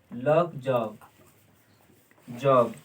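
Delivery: लॉक जॉब spaced out low for writing; लॉक जॉब, with a low falling close; slow dictation. Shown as a demonstration. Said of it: "इसको बोलता है लॉक".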